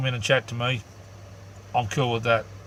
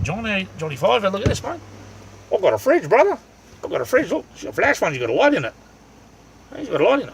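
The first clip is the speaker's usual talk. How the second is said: high, loud, delighted proud yelps; real thrill, much higher and louder; excited showing off; 10 semitones higher, 4 dB louder than usual. Real, not acted. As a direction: show off your new toy with glee. Proudly shows off new fridge.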